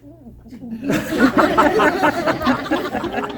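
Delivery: loud cackling laugh; loud laughing cackle; witch laugh. Acted. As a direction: cackle as the fuzzies hit. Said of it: "and she was like".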